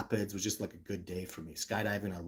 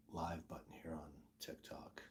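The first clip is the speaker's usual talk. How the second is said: whisper; 6 semitones lower, 18 dB quieter than usual. Real, not acted. Muttering to self fixing phone.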